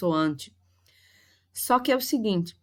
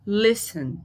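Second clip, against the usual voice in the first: Listen with first syllable strong, second weak; stress on first syllable. Shown as a demonstration. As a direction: punch the start of Listen. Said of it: "a sílaba mais forte foi o le". (Listen com a primeira sílaba mais forte)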